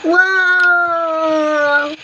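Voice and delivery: long pretend "waah"; mock baby wail. Baby sibling interrupting online class, drawn-out mock wail. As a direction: bawl like a baby, put on.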